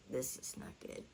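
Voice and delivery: hushed, voiceless worry; breathy aside at the hospital; whispered thought. Retold or imitated. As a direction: whisper it to yourself, alarmed. Inner thought on hearing screams.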